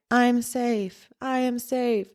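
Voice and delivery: high, fragile, scared little voice; barely daring to say it; timid broken affirmation; weak and broken. Retold or imitated. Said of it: "a very weak, a very broken".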